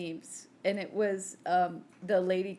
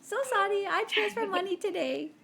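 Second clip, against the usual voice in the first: much higher put-on voice, playfully apologetic; mock sorry voice; 11 semitones higher than usual. To her daughter about the late Easter money, high mock apologetic voice. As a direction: squeak out a cutesy fake apology.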